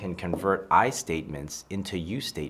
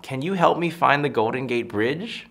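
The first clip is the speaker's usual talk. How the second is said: higher-pitched question, rising at the end; calling out; 6 semitones higher, 4 dB louder than usual. Retold or imitated. Woman asking strangers for directions, calling out sweetly. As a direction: call out a polite plea for help. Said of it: "she starts yelling out".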